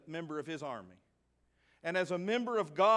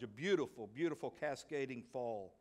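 ordinary talk

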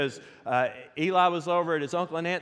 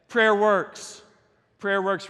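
preaching emphasis; 3 semitones higher, 4 dB louder, much slower than usual. Real, not acted.

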